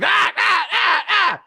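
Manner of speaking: series of high, loud mock screams; repeated short high yells; crowd yelling. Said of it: "a bunch of people in a room going".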